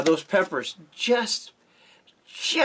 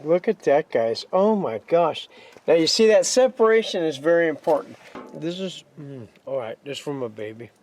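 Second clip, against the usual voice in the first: ordinary delivery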